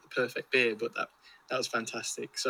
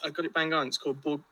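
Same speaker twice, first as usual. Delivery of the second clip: usual speaking voice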